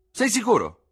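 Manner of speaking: high, loud call from a window above; surprised old doctor shouting from the window; old army surgeon calling down. Acted. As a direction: call down to the street, puzzled. Surgeon asks.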